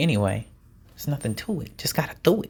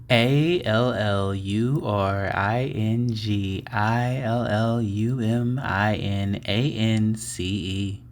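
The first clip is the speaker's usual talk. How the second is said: slow soulful spelling of each letter; slow spelled out with feeling; much slower than usual. Shown as a demonstration. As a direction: put some soul into the letters. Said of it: "put, like, some soul into it".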